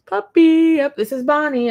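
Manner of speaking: high, loud doting pet voice; puppy-talk voice. Real, not acted. Owner shows off her dog Bonnie, high puppy-talk voice.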